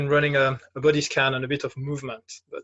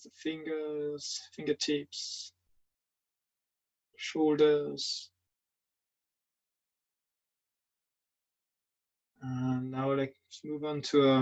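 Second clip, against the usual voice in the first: guided meditation; 4 dB quieter than usual. Acted. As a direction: guide them gently through a standing body scan, leaving long silences. Leads a standing meditation.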